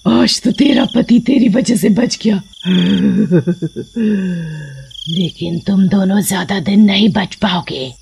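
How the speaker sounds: cackling menacing witch; witch warning. A narrator's reading. Witch warns the couple before vanishing. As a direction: vow revenge with a cackle.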